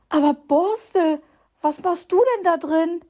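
viel höher und lauter vor Schreck; überrascht aufgeschreckt; erschrocken. A narrator's reading. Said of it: "erschrak Frau Igel". (erschrockener Ausruf, hoch und laut)

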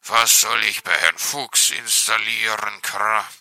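loud, rough raven caw; croaking raven. A narrator's reading. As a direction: bark it out like a grumpy raven. Narrator voices raven.

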